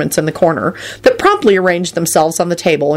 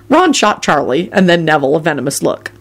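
ordinary delivery

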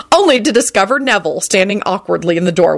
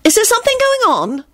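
Rosie's voice. A narrator's reading. Rosie presses him.

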